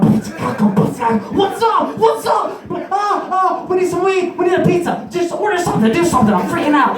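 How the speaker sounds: high, frantic mock yelling; acted freakout. Acts frantic tripping outburst.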